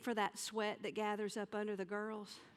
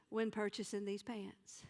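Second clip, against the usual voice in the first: ordinary delivery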